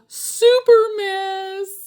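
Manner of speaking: high, slow, dragged-out wail; super mess drawn out high; exasperated climax. A narrator's reading. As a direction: stretch it out in mock despair. Climax of the bad-manners list.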